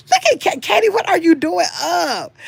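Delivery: playful surprise. Real, not acted. Teasing a viewer up late.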